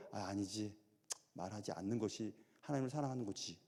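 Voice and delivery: soft, low muttered self-check; a hushed voice, quietly changing your mind; quiet inner voice. Retold or imitated. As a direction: say it softly to yourself, realizing. Voicing a believer's inner thought.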